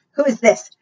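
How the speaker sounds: usual speaking voice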